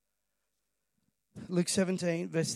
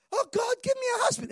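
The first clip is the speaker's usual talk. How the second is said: much higher pleading whine; whiny mock prayer. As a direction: beg it like a needy churchgoer. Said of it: "We complain".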